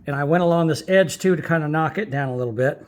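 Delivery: ordinary delivery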